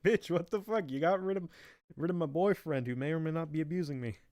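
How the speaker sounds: hammed-up outrage, high-pitched; Roserade. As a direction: rant in mock indignation, pitched well above normal. Roserade's acted angry complaint.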